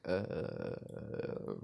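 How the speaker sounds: long, low drawn-out "uh"; imitating someone busted and stuck; caught-out stalling. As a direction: stall on a low creaky hum.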